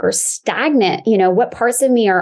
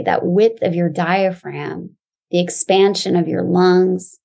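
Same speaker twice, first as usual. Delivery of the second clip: calm grounding exercise. Acted. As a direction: guide them gently into the body.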